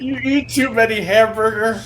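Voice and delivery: mock caricature.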